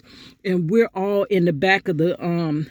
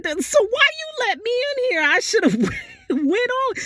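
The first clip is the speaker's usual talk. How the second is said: squeaky high-pitched disbelief, laughing through it; high mock outrage. Telling a friend how the staff member trapped her, high incredulous squeal. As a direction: shriek it like it can't be real. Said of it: "I'm like".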